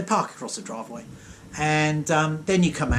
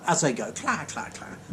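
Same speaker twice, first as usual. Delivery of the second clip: clatter sounds; 4 dB quieter than usual. Retold or imitated. Imitated scattering noise.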